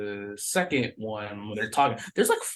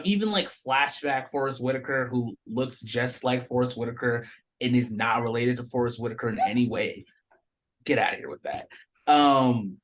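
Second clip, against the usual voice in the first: ordinary talk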